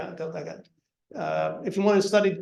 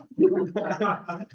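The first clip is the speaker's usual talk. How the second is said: short laugh; 3 semitones higher than usual. Real, not acted. Laughing at old photo.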